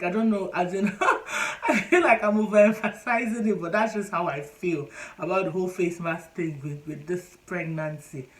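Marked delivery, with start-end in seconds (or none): 0.9-3.6 fast, louder, laughing through the words. Real, not acted. Admitting to viewers she's overdoing it, laughing. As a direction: laugh at yourself mid-rant. Laughing at her own complaint.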